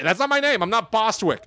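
put-on protesting child. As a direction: insist on who you are, pitched high. Voicing the trapped baby protesting.

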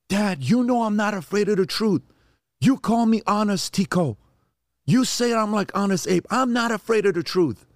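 put-on high, pleading boy's voice; son screaming upset. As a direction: plead like a kid in tears on the phone. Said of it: "I hear my son in the back screaming".

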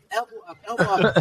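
high, fast call from the sidelines; coach yelling. Retold or imitated. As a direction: shout instructions to the batter. Dad calling to son batting.

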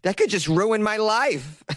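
anxious Boston witness. Acted. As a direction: play a Bostonian woman dreading exposure. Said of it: "she wants to hide her identity".

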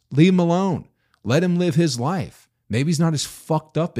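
angry retelling of telling off the couple. Retold or imitated. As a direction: snap at the parents to back off. Scolding the divorced couple.

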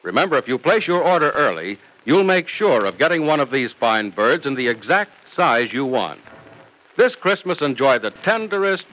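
ordinary talk, nothing marked

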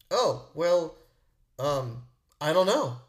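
lightly voiced stumped reply; stumped relative. Relative asked for a solution, mock stumped and halting. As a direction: imitate someone hesitating, with slow, halting words. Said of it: "And he goes".

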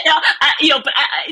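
talking high and loud through laughter; laughing. Real, not acted. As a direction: laugh it off, then keep talking. Still laughing after the joke.

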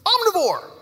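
put-on high, proud child's voice; smartest kid. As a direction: answer like the class know-it-all. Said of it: "the smartest one says".

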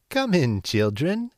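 put-on high, sugary old woman's welcome; sweet old woman. A narrator's reading. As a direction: lure them in with fake sweetness. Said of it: "she said, smiling".

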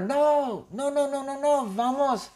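high, insistent acted yell; shouting back. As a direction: holler a refusal back in a very high voice. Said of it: "Pablito le responde y le grita a Connor". (gritando en respuesta, muy agudo)

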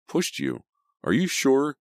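incredulous question leaping high at the end; demanding. A narrator's reading. Sydney questioning injured Emma's claim, demanding. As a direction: demand to know, pitch jumping up. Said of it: "Sydney demanded".

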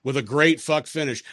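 ordinary talk, nothing marked

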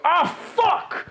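sudden high cry of pain, mock scream; pained yelp. Bandit falling into the spiked pit cries out in a high yelp. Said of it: "you hear somebody go".

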